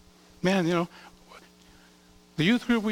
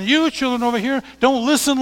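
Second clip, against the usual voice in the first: scolding father; 6 semitones higher, 6 dB louder than usual. Acted. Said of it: "He's telling his children".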